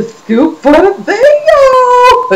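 high, loud, drawn-out holler; exuberant sign-off. Real, not acted. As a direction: sing out the closing to the audience with a whoop.

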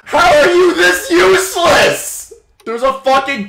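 high, loud, cracking rage at a teammate; exasperated yell. Real, not acted. Gamer raging at a useless teammate, cracking exasperated yell. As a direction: cry out in disbelief at how bad they are.

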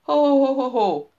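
loud, high jolly Santa laugh; Santa's ho ho ho. A narrator's reading. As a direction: answer with a hearty Santa ho-ho. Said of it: "nyomban ráfelelt". (vidám, harsány télapós nevetés)

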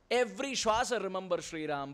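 ordinary talk, nothing marked

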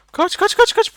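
high frantic shouts to run; panicked yelling. Real, not acted. Gamer fleeing a monster, panicked high yell. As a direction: scream at yourself to flee.

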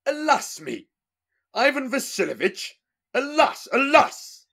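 high wailing lament. Acted. Tsar despairing over his fate, high wail.

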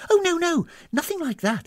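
high, hurried denial; startled. A narrator's reading. Hostess denying guest's accusation, startled. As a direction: protest in a fluster. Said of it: "startled at Tanisan's reaction".

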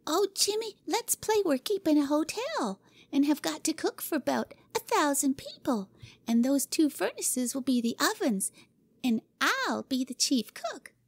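put-on high, eager child spinning a game; thrilled by her own idea; excited little girl. Cora proposing the hotel game to Jimmy: excited high girl voice. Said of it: "suddenly cried out".

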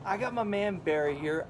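ordinary talk

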